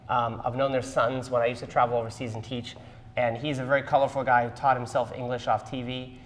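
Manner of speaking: ordinary delivery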